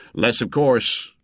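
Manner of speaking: slow, drawn-out mock politeness; boatman's heavy sarcasm; obvious sarcasm. A narrator's reading. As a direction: stretch it out sarcastically. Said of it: "went on with obvious sarcasm".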